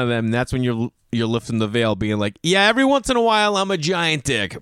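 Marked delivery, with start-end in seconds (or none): 2.4-4.6 higher, mock-defiant admission; hammed-up owning up; brazen confession. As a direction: blurt it out, then draw out the ending. Acted blunt confession to parents.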